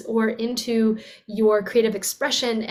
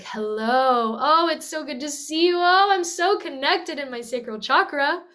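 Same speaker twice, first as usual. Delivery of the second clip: higher, gliding, delighted greeting; flowy, connected; 4 semitones higher than usual. Shown as a demonstration. Voice teacher showing water quality in speaking, flowy and connected. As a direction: let the words swoop with pleasure. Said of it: "Or is it gonna sound really like".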